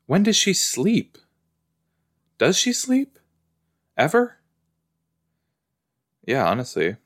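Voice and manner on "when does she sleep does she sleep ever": disbelieving, pitched way up; high incredulous. Real, not acted. Incredulous questions.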